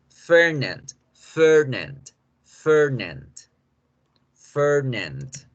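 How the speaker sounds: Fernand with long er, open English a; English pronunciation. Shown as a demonstration. Teacher repeats the correct form, English Fernand. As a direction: draw out the er in Fernand, falling tone. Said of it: "Esa sería la forma correcta de decir Fernando".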